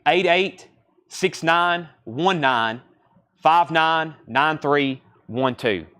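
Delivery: digits read out slowly with pauses; slow, deliberate number readout for someone copying it down; slow dictation. Real, not acted. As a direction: dictate the number in small, spaced groups. Repeating barcode for viewers.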